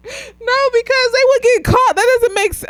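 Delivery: very high, squeaky laughing objection; laughing protest. Real, not acted. Viewer baffled that they would get caught, laughing protest. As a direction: protest while helpless with laughter. Cracking up over a plot hole.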